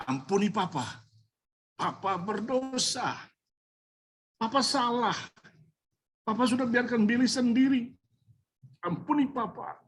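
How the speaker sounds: a father begging his son's forgiveness, near tears; tearful plea. Retold or imitated. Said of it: "Saya menangis di depan Billy".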